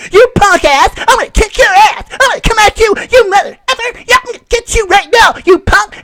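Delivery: high, shouting mock threats; hammed-up imitation of a woman picking a fight; mock tough-girl threat. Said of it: "they act like they're gonna fight a dude".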